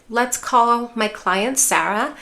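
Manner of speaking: usual speaking voice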